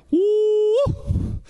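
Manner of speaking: long, very high "woo"; revival whoop. Real, not acted. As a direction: hold out a high whoop after the climax. Preacher's whoop after the climax.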